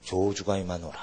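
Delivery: low, hushed voice of doom; retold prophetic curse, dropped low; solemn curse pronouncement. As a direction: intone it like an oracle, low and soft. Prophecy bursting out of him, retold.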